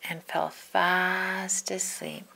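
slow whispered drifting off; slow sleepy storybook whisper. A narrator's reading. Puppy falls asleep.

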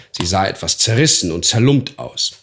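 plain reading voice

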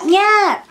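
tiny high squeak of a newborn; newborn's tiny cry. Retold or imitated. Mother imitating her newborn's first sound, tiny high squeak. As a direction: squeak it like a baby barely crying. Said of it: "nada más le hizo".

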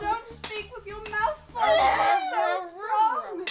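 mock wailing scold. Mock scolding, loud wail.

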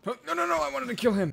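high excited yelling; excited shout. Real, not acted.

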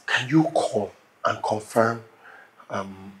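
very soft, low, halting plea; apologetic pleading. Acted. Husband begging nurse not to be angry, hushed apologetic plea. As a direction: tread carefully, hushed and slow to finish.

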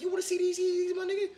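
high squeal with a laugh; squealing tease. Acted. Host teases viewers about his new Yeezys in a high squeal.

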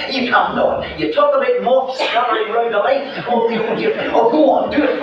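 put-on high, coaxing old women's voices; teasing old ladies. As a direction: urge him on like eager old dears. Old ladies egg him on.

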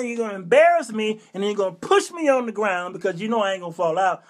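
usual speaking voice

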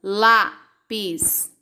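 "Lápis" split into Lá, pis; "Lápis" with a pause after Lá; syllable by syllable. Shown as a demonstration. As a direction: sound out "Lápis" in two separate beats. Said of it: "Quantas sílabas?".

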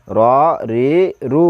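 র রি রু with each vowel held long; one-alif elongation. Shown as a demonstration. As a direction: stretch the vowels in র, রি, রু evenly. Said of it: "এক আলিফ করে টানে পড়তে হবে".

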